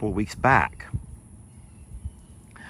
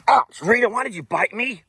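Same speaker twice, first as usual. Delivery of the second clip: much higher, startled ouch at the animal; surprised high scold of a nipping animal; startled pet talk; 14 semitones higher than usual. Real, not acted. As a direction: yelp then ask the pet why it bit. Bitten by the animal.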